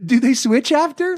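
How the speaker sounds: imitating a child's high-pitched giggle; high, drawn-out mock giggly voice; giggling kid. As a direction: mimic a giggling kid, slow and high through the question. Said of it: "And he starts giggling".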